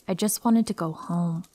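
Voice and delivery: usual speaking voice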